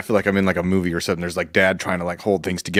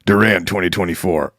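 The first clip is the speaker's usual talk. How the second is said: ordinary talk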